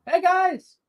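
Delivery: high, loud hail; bright high-pitched shout; yelling greeting. Acted. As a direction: yell hello to friends far off. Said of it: "is yelling 'cause he can hear them".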